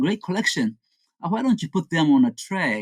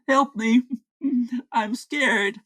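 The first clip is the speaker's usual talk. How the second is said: mock scared; 8 semitones higher than usual. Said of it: "He is screaming help and I'm scared".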